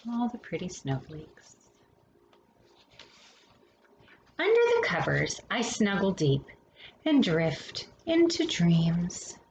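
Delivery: gentle bedtime picture-book read-aloud; storybook reading. Acted. Reading a winter picture book to children, gentle storytelling.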